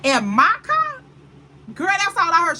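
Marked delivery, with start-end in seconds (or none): 0.0-1.0 louder, higher outraged retold yell; livid outburst. Friend learns her man took her car, outraged yell. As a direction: let the disbelief burst out loud. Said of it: "She was livid".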